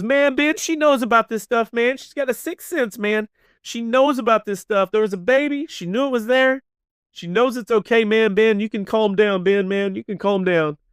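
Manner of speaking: high-pitched, frantic insistent voice; worked-up husband. Retold or imitated. Kelly tells Ben she has a sixth sense, worked up. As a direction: gush it high, utterly convinced. Kelly excitedly vouches for Kat.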